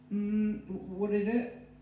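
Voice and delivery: high, hesitant voice from behind the door; sleepy woman's voice. Acted. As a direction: answer the knocking warily. Mary answers from inside.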